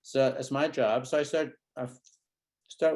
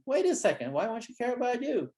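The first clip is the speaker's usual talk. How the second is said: mock puzzlement; 9 semitones higher than usual. Shown as a demonstration. Therapist questioning a child in a demo, mock puzzlement. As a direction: play dumb to draw him out.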